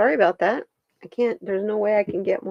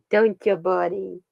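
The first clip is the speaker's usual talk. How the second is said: ordinary talk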